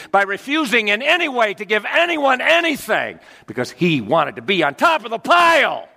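rising to a loud shout; building outrage. Acted. Preacher denouncing the tyrant king, building to a shout. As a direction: build to the top of the pile.

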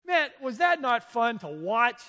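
God's praise. Pretend. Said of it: "I want God to look at that and go".

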